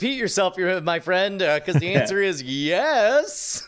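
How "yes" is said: "yes" drawn out slowly; emphatic yes. Pretend. Volunteer agreeing to test the jetpack, emphatic drawn-out "yes". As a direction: draw out "yes" as a big answer.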